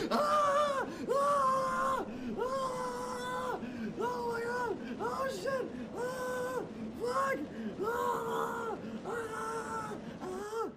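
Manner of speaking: terrified screaming on a coaster. Real, not acted. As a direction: scream in real panic. Riding an intense virtual coaster.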